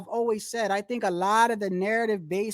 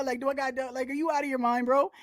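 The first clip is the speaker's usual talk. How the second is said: ordinary talk, nothing marked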